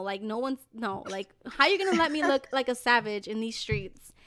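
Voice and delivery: usual speaking voice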